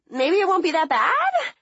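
high, small hopeful squeak; squeaky voice. Retold or imitated. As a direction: squeak it out, doubtful. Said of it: "I squeaked".

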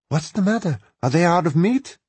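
high, lightly voiced wife; wife's voice. Wife greets returning husband.

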